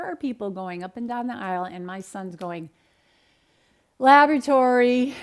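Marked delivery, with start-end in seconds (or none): 4.0-5.1 put-on kid calling out. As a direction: belt it out for the whole aisle. Son calling out verdict in store aisle.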